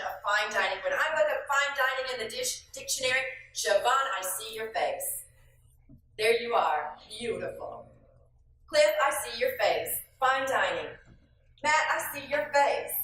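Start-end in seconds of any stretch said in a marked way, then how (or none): none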